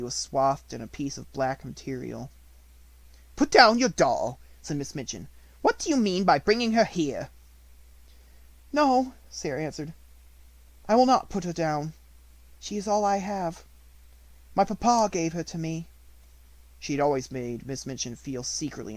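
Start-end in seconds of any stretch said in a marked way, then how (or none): 3.4-7.3 louder, harsh headmistress voice; domineering schoolmistress, louder; stern Miss Minchin. A narrator's reading. Miss Minchin's lines in story. 8.7-15.9 put-on higher, louder child's voice, firm; a grieving girl holding her ground; steady young Sarah. A narrator's reading. Sarah defies Minchin.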